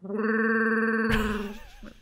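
long rolling, high-pitched Wookiee trill; Chewbacca growl. Shown as a demonstration. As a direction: attempt a sustained Chewbacca call. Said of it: "I have to make it deeper".

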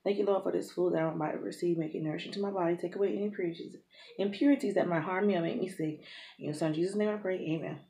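quick quiet mealtime prayer; saying grace. Acted. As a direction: murmur grace fast before eating. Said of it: "We're gonna say grace".

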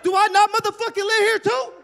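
high, loud hammed-up outburst; mock tough yell. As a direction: bark it like a wannabe boss.

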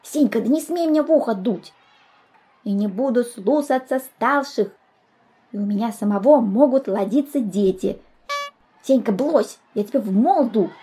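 put-on petulant boy with babyish r and zh; fat little boy. Fat boy makes demands while teased, childish protest.

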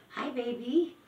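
high, loud cooing. Real, not acted. New mama greets the kitten with loud high cooing.

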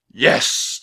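loud, forceful knightly yes; booming single-word verdict; thundering. A narrator's reading. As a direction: thunder out your assent. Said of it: "thundered the Christmas knight".

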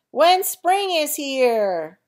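slow, loud joyful call; loud cheer. Acted. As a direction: draw it out like a cheer. Animals cheering together.

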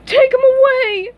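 high, loud squealed plea; panicked scream. Acted. Patrick begs the cop to remove it, panicked. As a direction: squeal it out frantically. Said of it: "Patrick screamed again".

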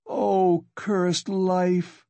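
high-pitched cry of despair; despairing exclamation. A narrator's reading. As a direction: lament it high and drawn from despair. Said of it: "exclaimed in despair".

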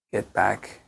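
normal talking voice

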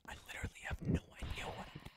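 fast unvoiced whisper; hushed confession, rushed. Real, not acted. Whispering aside.

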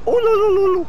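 mock moan. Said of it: "and I will go".

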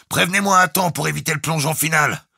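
high, loud bark into a speaking tube. A narrator's reading. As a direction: bark it up to the bridge. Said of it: "aboya Salomon Rout dans le porte-voix". (aboyé d'une voix forte)

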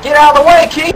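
high, loud shout rising at the end; urgent shout. Retold or imitated. Warning Keith to move from the snake, loud rising shout. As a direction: yell a warning at your buddy. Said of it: "And I'm like".

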